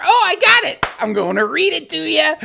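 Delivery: sudden high, loud burst of discovery; excited. Real, not acted. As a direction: shout it out like you just found treasure. Finally finds the letter.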